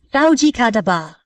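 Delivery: usual speaking voice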